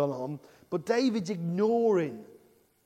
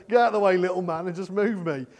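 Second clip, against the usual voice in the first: louder jokey order; son's bossy order; 6 dB louder, faster than usual. Retold or imitated. Son Jacob lifting his dad aside, mock bossy and louder. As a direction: push him aside with a cheeky bark. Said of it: "he used to just pick me up".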